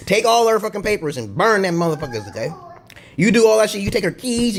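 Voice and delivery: ordinary talk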